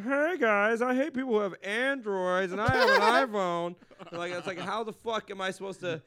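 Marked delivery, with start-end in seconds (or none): 0.0-3.7 loud, higher cheesy stand-up voice; mock comedian bit. To a Zoom crowd, a loud hammed-up comic bit. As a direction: ham up a corny comic opener. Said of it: "I said".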